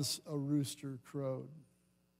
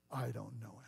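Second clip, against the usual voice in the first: muttered denial; 6 semitones lower, 11 dB quieter than usual. Acted.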